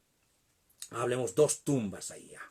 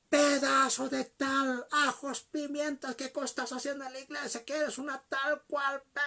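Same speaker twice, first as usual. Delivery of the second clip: cursing tirade; 10 semitones higher than usual. Retold or imitated. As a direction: act out the pastor cursing his wife. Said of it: "comenzó a maldecirle". (diatriba furiosa y aguda, imitando insultos)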